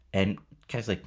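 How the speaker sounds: ordinary talk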